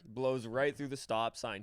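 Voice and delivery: usual speaking voice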